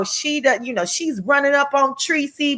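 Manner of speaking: normal talking voice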